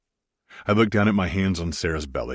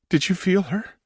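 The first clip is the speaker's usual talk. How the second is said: higher woman's voice, amazed; wide-eyed question; 13 semitones higher than usual. Acted. Wife asks Maximus if he felt the baby kick, amazed. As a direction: ask it pitched up with wonder. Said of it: "Sarah asked with wide eyes".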